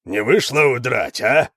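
taunting bark of a ruffian; barking brute. A narrator's reading. Hara catches fleeing Lita, barking a taunt. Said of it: "рявкнул тот".

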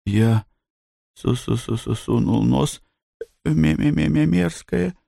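stuttering, tongue-tied and faltering; stammering babble. Acted. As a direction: stumble over each syllable in fright. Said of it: "Блум заплетающимся языком лепечет".

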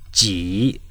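ordinary talk, nothing marked

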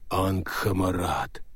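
low, slow hollow voice of Death; booming Death voice. Acted. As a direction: speak as Death, deep and echoing. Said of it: "повторил гулкий голос".

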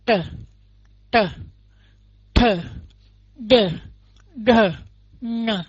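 tờ đờ nờ với lưỡi uốn cong; retroflex demo. Shown as a demonstration. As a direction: curl the tongue back on each tờ đờ nờ. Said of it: "Quý vị đọc à". (phát âm uốn lưỡi)

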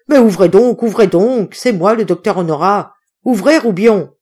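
loud, impatient calling through a door; urgent shouting. A narrator's reading. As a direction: shout insistently to be let in. Doctor pleading outside locked door.

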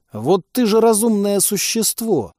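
возмущённо, выше и медленно; возмутись, растягивая слова. Acted. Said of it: "возмутился Ваня". (Ваня возмущается на пленницу, медленно и выше)

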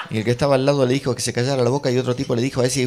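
ordinary talk

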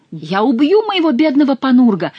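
high, loud pleading wail; plaintive cry. A narrator's reading. Monk pleads with Chicot for his donkey, plaintive and loud. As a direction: wail it out to win pity. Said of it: "жалобно кричал монах".

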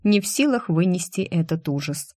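ordinary delivery

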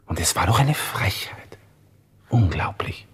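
indignant whisper under his breath. Acted. Lieutenant whispering his outrage over the insult, whispered. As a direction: hiss it privately, outrage kept inside. Inner monologue, recalling the insult.

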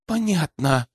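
lightly voiced reply from a companion; Dina's subdued answer. A narrator's reading. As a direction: acknowledge the order in a slightly lifted, restrained voice. Dina answers the hissed order. (сдержанный ответ напарницы)

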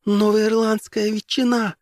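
high, slow delighted gasp. Acted. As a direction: gasp with greedy joy. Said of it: "ахнула за его спиной Розалин".

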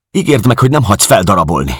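high, loud, rushed begging; urgent plea. Acted. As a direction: beg for the promise in agitation. Helen begs for a promise. (sürgetően, magas hangon könyörögve)

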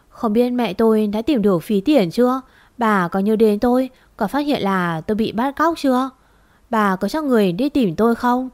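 plain reading voice

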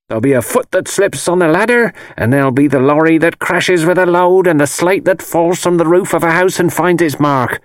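put-on prophesy disaster as a fierce old crone; doom-saying old crone. A narrator's reading.

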